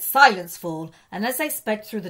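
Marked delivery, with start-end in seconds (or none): 0.0-0.9 sharp loud "Silence", then drops; cold snap. A narrator's reading. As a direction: cut him off like an icy witch. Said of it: "she merely said".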